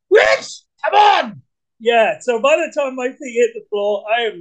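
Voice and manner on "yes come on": high, loud morning victory yell; whooping. Acted. Psyching up for a positive day, whooping. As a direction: whoop it out to pump yourself up. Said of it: "I'm whooping, fist pumping".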